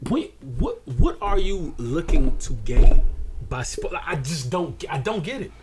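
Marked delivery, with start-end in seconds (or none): 0.1-3.0 loud, drawn-out disbelieving question; loud slow exasperated what are you looking to gain; exasperated outburst. Real, not acted. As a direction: demand it, fed up and slow. Ranting at spoilers on social media.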